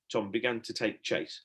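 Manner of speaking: ordinary delivery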